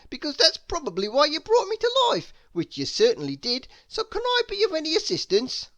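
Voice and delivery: high, fast, rambling snowman voice; talkative snowman. A narrator's reading. Mister Snow offering Santa help: high rambling chatter. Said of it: "a rather talkative sort of snowman".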